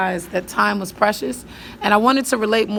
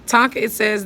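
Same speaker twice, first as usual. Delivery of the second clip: normal talking voice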